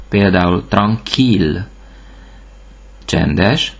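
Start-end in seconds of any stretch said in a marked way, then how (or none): 0.7-1.7 tranquille ending in a sounded L; French tranquille, L heard at the end; ILL with L. Shown as a demonstration. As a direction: say tranquille as "tron-keel". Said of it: "I L L betűcsoportot ill-nek ejtjük".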